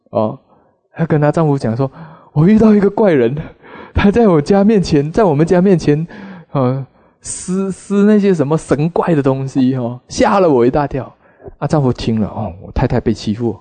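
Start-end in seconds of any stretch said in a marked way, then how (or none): 2.3-11.1 frightened report. Retold or imitated. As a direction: blurt out the fright to her husband. Frightened wife tells her husband.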